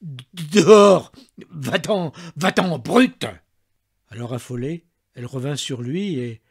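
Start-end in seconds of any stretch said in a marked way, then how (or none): 0.0-3.3 loud, shaking cry to get out; father ordering the maid out, trembling; trembling furious order. A narrator's reading. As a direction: bark him away, trembling with rage. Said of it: "tremblant de la tête aux pieds".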